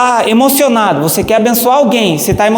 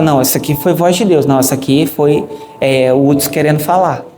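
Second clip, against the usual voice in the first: dismissive doubt; 7 semitones lower than usual. Acted. Acting someone doubting God's voice.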